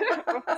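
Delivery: laugh. Real, not acted. Laughing at own accent.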